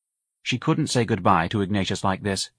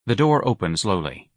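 usual speaking voice

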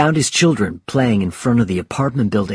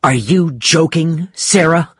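angry retort; 7 semitones higher, 3 dB louder than usual. Acted. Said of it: "Mike stared back angrily".

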